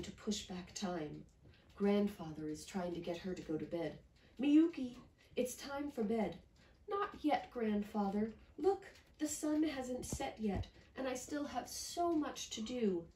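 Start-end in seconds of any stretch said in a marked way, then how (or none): 4.4-6.4 grandfather calling. Acted. Grandfather calls child to bed. 6.9-13.1 put-on high, eager young girl pleading; higher, bright child protesting; little girl voice.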